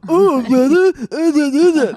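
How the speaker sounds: mock him loudly with a dopey resonant put-on; catty impression of men. Mocking a man who hurt her, catty impression. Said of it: "when I'm being really catty about something a man said".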